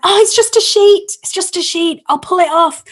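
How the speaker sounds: high, loud cry of discovery; acted exclamation. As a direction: play up the relief for a group of children. Acted reassurance to children about ghost.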